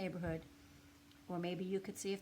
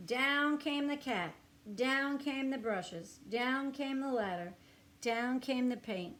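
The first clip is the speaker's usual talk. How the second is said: higher, louder dramatic build; building excitement; 5 semitones higher, 6 dB louder, slower than usual. A narrator's reading. Everything tumbling down, excited storytelling. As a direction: pile on the drama as everything falls.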